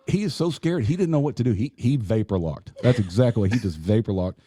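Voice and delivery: normal talking voice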